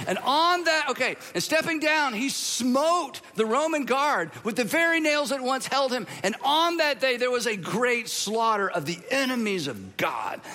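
high, emphatic storytelling; mock-epic scripture narration. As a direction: proclaim it like a triumphant battle legend.

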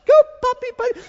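loud, high falsetto command; urging the puppy. Retold or imitated. Owner urges puppy in the men's bathroom, high falsetto urging. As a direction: squeak encouragement to a dog.

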